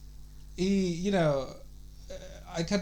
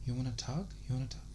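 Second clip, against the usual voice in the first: creepy whisper-like voice, softer; low creepy voice; 4 semitones lower, 11 dB quieter, faster than usual. Retold or imitated. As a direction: hush it low and creepy like a caller. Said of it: "In a very fucking low, creepy voice".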